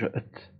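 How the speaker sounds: "ات" breathed out unvoiced, a short e before the t, not ee; whispered ending sound. Shown as a demonstration. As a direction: isolate the ending as a soft "ات". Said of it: "ایت نمیگم آخرش".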